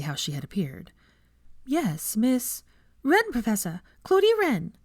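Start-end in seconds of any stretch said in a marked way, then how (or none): 1.6-2.6 lightly raised, friendly teacher voice; a hint of a nervous young professor; warm, slightly self-conscious welcome; Hermione, new teacher. A narrator's reading. Hermione calls on student. 3.0-4.8 high, bright eager student voice; a keen young pupil introducing herself; higher, keen young voice; eager schoolgirl. A narrator's reading.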